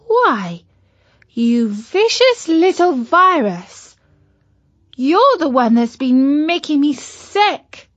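high, slow indignant scolding. Acted. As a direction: tell off the nasty virus. Katy scolds the virus.

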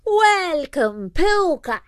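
high-pitched banshee greeting, falling at the end; called-out welcome. A narrator's reading. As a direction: hail a guest across the hall in a high voice. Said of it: "she called out".

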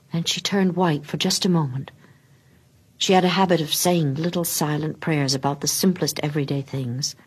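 ordinary delivery

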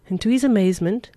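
ordinary delivery, nothing marked

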